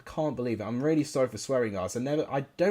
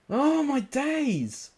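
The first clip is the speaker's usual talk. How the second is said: much higher, very slow and drawn out; dismayed groan; 13 semitones higher, 3 dB louder, much slower than usual. Real, not acted. Gamer dismayed at the match, high and drawn out. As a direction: stretch it out in dismay.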